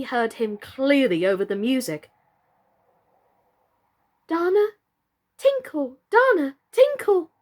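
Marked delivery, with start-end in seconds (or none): 4.3-7.3 higher, fluttery and panicked; fluttered. Acted. Birdie the doll panicking at the noise, fluttering. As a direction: flutter the words in confusion. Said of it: "fluttered Birdie".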